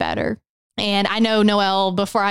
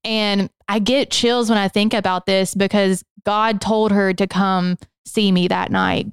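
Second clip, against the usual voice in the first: normal talking voice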